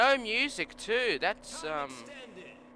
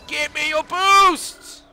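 demanding yell; 7 semitones higher, 8 dB louder than usual. Real, not acted. Racing game rival steals boost.

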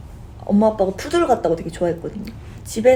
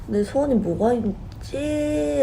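slow, drawn-out wondering to herself; slow musing; 6 semitones higher, slower than usual. Retold or imitated. Wondering what to wish for before the cake, slow. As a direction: ponder it at leisure. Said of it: "라고 생각하다가".

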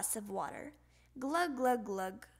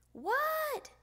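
put-on child's answer; 3 dB louder than usual. Froggy answering mother's call.

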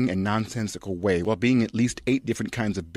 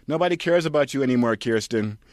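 mock scolding; 5 semitones higher, 4 dB louder than usual. [name] retake.

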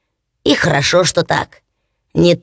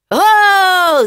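loud, much higher hail; loud cry; 10 semitones higher, 4 dB louder than usual. Acted. Hans hailing his brothers from behind, loud cry. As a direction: call out to catch the brothers. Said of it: "закричал Ханс Чурбан".

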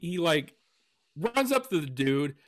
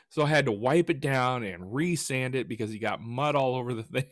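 normal talking voice

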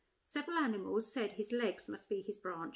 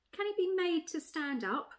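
lightly musing elephant, higher pitch; thoughtful; 7 semitones higher than usual. A narrator's reading. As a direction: wonder aloud as the elephant. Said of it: "said the elephant thoughtfully".

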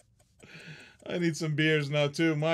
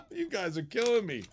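ordinary talk, nothing marked

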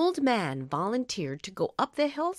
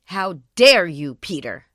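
indignant; 4 semitones lower, 9 dB louder than usual. A narrator's reading. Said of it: "said the villagers".

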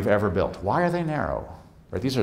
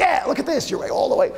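excited parent; 13 semitones higher than usual. Retold or imitated. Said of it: "parents and students go".

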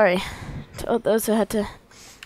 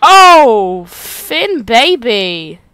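put-on doting baby voice; 2 semitones higher, 12 dB louder, much slower than usual. Player gushing over the character Finn, doting coo. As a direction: gush over him like a baby.

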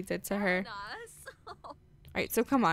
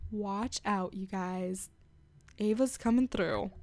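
soft, half-whispered hype; hushed mock warning; 3 semitones lower, 7 dB quieter than usual. Gamer warning viewers Ava's coming through, hushed mock warning. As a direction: drop to a hush to hype her up.

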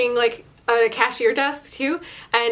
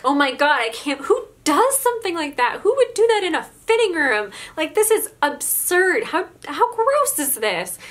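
high-pitched disgusted outburst, slowing down; shocked teen recoiling at a gross mess; 6 semitones higher than usual. Retold or imitated. Coworker recoils at the mess, disgusted and high.

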